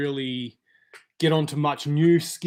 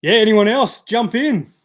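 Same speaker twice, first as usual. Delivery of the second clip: calling out; 7 semitones higher, 9 dB louder than usual. Real, not acted. Calling out to the viewers.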